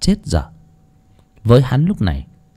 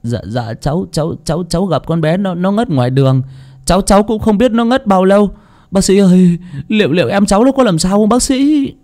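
stammering, rising higher and anxious; shaky pleading to the doctor; nervous stutter climbing in pitch; trembling; 8 semitones higher than usual. Acted. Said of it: "Tùng run rẩy".

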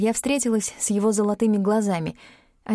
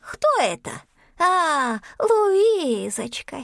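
very high-pitched little squeak; squeaky creature voice; 9 semitones higher, much slower than usual. A narrator's reading. Tiny creature underfoot recognises heroine, squeaky. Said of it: "раздалось писклявое".

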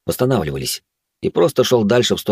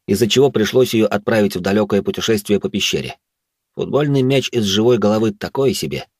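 ordinary delivery, nothing marked

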